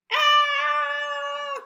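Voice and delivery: high loud shout; mock scream. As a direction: play the flood alarm. Sound while reading.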